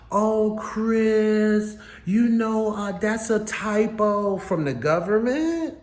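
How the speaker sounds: mocking excuse voice. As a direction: play dumb like a guilty liar.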